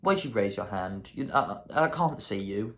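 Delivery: ordinary talk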